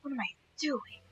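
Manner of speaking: a breathy whisper to herself; hushed self-questioning whisper. Real, not acted. Wonders aloud at her own play.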